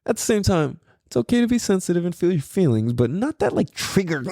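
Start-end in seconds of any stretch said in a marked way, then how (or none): none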